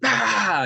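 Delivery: sudden high, loud anguished cry; Gollum shriek. Retold or imitated. Preacher imitating Gollum losing control, high anguished cry. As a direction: shriek like a creature losing its mind. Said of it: "And he goes".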